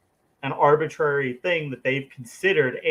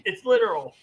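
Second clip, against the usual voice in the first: high insistent mock literalist; 8 semitones higher than usual. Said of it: "he's like".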